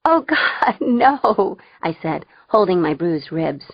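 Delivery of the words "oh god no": high, helpless laughing plea; cracking up. A narrator's reading. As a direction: say it through laughter. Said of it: "and then we cracked up".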